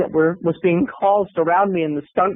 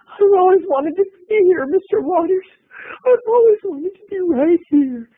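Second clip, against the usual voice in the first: high, emotional gushing; near-tearful starstruck gush; gushing high cry; 14 semitones higher, 3 dB louder than usual. Retold or imitated. Gushing to John Waters.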